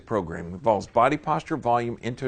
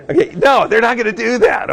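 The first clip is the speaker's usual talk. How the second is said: much higher and louder, flatly dismissing the idea; emphatic denial; 11 semitones higher, 13 dB louder than usual. Real, not acted. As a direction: shoot it down loud for the room. Emphatic rebuttal to the audience.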